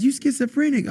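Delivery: very high-pitched mocking accusation; imitated scolding. Girlfriend dismissing his vision of hell, mock scolding. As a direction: mimic an indignant girlfriend. Acted quote of angry girlfriend.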